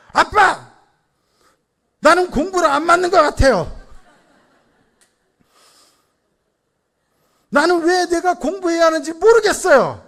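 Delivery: loud, high-pitched imitation of a daughter talking back; hammed-up defiant outcry at dad; protesting child. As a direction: cry out like an indignant kid. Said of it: "되려 항변합니다".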